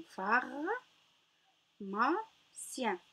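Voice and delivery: "phare. Ma. Sien" as three separated syllables. Shown as a demonstration. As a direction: sound out "phare. Ma. Sien", first two rising. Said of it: "combien nous avons ici".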